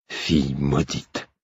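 put-on drunk father's curse. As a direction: curse your daughter from the corner, drunk. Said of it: "la voix du père de Molly".

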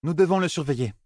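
mildly direct, high character voice; high-pitched, understated directness. A narrator's reading. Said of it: "toujours aussi directe".

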